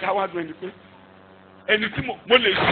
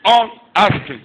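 slow exhortation; 3 semitones higher, 4 dB louder, much slower than usual. Acted. Preacher exhorting congregation.

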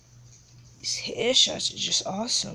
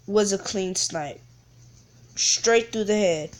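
ordinary delivery

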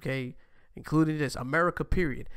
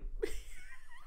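squealing laugh; 8 dB quieter than usual. Real, not acted. Laughing at clip.

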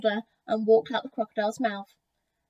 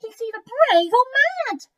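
high, loud monkey exclamation; excited, pitched-up animal chatter; 12 semitones higher, 7 dB louder than usual. A narrator's reading. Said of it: "chattered a monkey".

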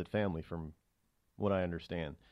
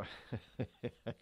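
chuckle; 10 dB quieter than usual. Real, not acted. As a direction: chuckle quietly to yourself. Chuckles after his own remark.

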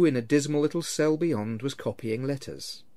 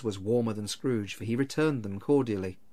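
ordinary delivery, nothing marked

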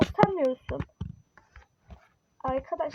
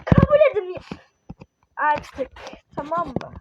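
exasperated raised voice; angry; 5 dB louder than usual. Real, not acted. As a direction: burst out in frustration. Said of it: "Sinirliyim bayağı".

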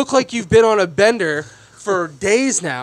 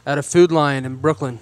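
ordinary delivery

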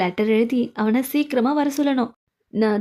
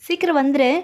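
ordinary delivery

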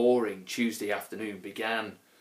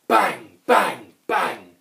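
popping bangs; 2 semitones higher, 7 dB louder, much slower than usual. A narrator's reading. Balloons being popped in the story.

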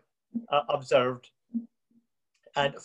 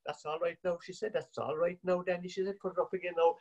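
much softer, gentle reassurance; soft coaxing; 3 semitones higher, 8 dB quieter than usual. Retold or imitated. Mother reassuring Danny on the phone, much softer. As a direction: soothe him quietly. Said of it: "My mother was coaxing him".

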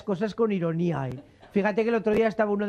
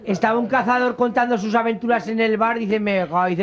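normal talking voice

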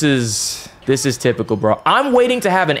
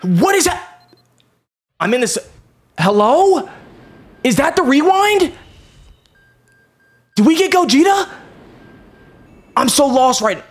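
stunned; 11 semitones higher than usual. Real, not acted. Confused by summon animation.

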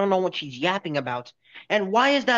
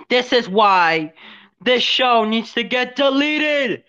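louder, slow, rising outrage; mock rant; 7 semitones higher, 4 dB louder, slower than usual. Mocking the cartoon, ranting that the show should be deleted. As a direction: drag out each word, fed up and yelling.

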